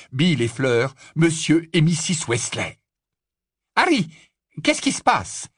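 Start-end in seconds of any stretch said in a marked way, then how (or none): 3.7-5.5 high-pitched alarmed greeting; urgent questioning. Acted. Lupin meeting Harry at the stairs, urgent. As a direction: call the name, then ask urgently.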